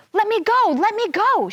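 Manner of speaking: high, loud panicked cry. Acted. Trapped fairy crying to be let go, high panic. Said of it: "she cried".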